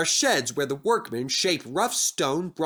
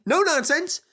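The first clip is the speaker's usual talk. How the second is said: high, louder sharp command; sharp puff; 4 dB louder than usual. Acted. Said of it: "puffed Percy sharply".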